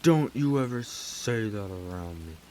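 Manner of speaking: loud, slow imitated warning; mock-menacing, drawn-out threat; really mad. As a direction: act out a furious warning, drawing out each word. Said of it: "He got really mad".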